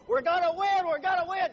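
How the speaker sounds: high, loud chant of victory; cheerleader yell. Retold or imitated. Cheerleaders rallying the crowd, loud high chant. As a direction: belt it out like a pep rally cheer. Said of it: "cheerleaders run out yelling".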